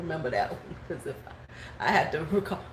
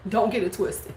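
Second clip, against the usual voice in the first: usual speaking voice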